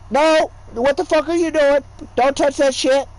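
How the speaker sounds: high, loud scolding shout; retold yell. Teddy yelling at relatives grabbing his games, loud scolding. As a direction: snap at the kids to stop.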